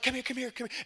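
softly urgent, acted-out giddiness; giddy excitement. Excited parents calling their children over, acted giddy and hushed. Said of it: "they're excited and they're giddy".

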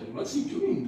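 a lightly voiced high squeal; squealing Boaz. Boaz spotting Ruth in his field, a light high squeal. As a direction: squeak the question like a smitten farmer. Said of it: "Boaz squealed".

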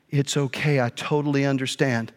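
low, soft, forgiving reply; gentle forgiveness. Retold or imitated. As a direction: imitate an older lady kindly forgiving. Lady forgives him at once.

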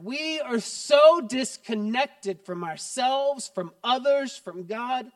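preaching climax. Acted. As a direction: drive the point home to the congregation.

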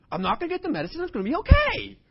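loud, high, laughing mock cheerfulness, growing wilder; manic mock cheer. Preacher mocking his own forced cheer in line. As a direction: ham up forced, loud cheer for comic effect.

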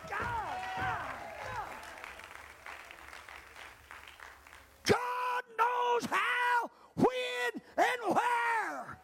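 fervent preaching. Acted. Preaching at full force.

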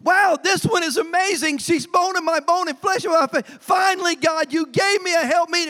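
Adam amazed at the wife God gave him, excited. Acted. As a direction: marvel at his new bride, overjoyed. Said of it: "he was all excited".

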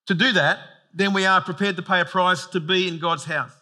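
ordinary delivery

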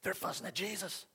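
high, soft stage whisper; hushed gossip. Acted. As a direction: pass on gossip under your breath. Onlookers whispering gossip about the quarrel.

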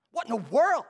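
loud rising "What in the world?"; mock outrage; indignant. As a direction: demand it in disbelief. Repeated accusing question.

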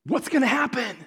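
lifted, curious second ask; eager wonder. Acted. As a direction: ask it again, keen to know. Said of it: "just to go".

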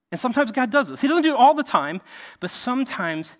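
ordinary talk, nothing marked

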